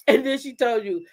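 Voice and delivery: ordinary talk, nothing marked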